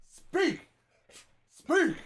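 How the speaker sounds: high-pitched, drawn command to a dog. Real, not acted. Owner tells the dog to speak, high-pitched command. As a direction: cue a dog brightly in a high voice.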